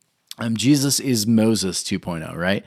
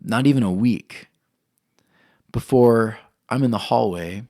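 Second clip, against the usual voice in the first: normal talking voice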